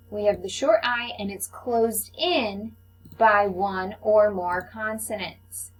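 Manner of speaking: ordinary talk, nothing marked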